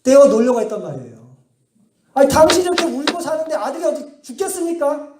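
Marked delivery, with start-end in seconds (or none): 2.1-5.1 higher, exasperated mock retort; a hint of an annoyed bishop snapping back. Bishop exasperated at weeping Monica, mock-irritated. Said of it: "열받게 해가지고".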